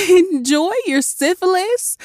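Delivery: high wheezing through laughter; repeating the insult while laughing. A narrator's reading. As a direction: gasp it out laughing. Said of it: "I wheezed".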